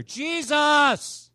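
high, loud, long-held cry; insistent pleading shout, words stretched out. Acted. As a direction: yell it out to be heard over the crowd. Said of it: "he yelled louder".

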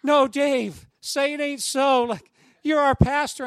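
mock horror, high and loud; imitating scandalised church friends, pleading loudly; horrified protest. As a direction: cry out in hammed-up dismay. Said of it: "they're, like, horrified".

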